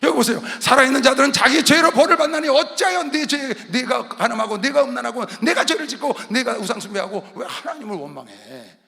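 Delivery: loud accusing sermon; forceful preaching. Real, not acted. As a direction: hammer out each charge. (크게 질책하는 설교)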